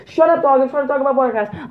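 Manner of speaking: high, loud, rushed scolding; exasperated yell. Real, not acted. Scolding the dog mid-recording.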